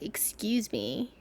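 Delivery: slow, soft, breathy snide excuse me. Retold or imitated. Cyclist brushing past her, snide excuse me. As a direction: say it under your breath, sneering. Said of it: "looked at me and was like".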